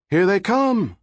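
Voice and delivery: high excited yell. A narrator's reading. Crowd members warn each other police approach, high yell. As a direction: shout the warning from the crowd. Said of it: "others were yelling".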